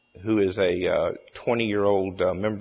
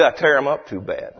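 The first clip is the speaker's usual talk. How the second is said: higher punchline in mock Cajun; Cajun storyteller; 6 semitones higher than usual. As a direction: land the joke in a lightly faked Cajun voice. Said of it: "tells the story in his hilarious Cajun accent".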